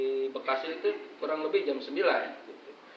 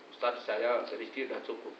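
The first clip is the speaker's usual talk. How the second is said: put-on humble old official; 4 semitones lower, 8 dB quieter than usual.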